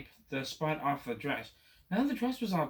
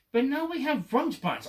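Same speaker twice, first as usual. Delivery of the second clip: higher and louder, a dismayed yell; 9 semitones higher, 6 dB louder than usual. Acted. Boy yells at the Cat about rug spots, loud. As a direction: yell it in alarm. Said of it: "I yelled".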